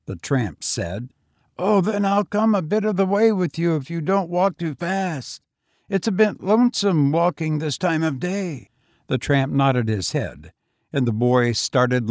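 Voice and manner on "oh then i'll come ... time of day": higher, youthful lad's voice; street boy. A narrator's reading. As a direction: play the lonely young tramp, higher. Boy's voice in the story.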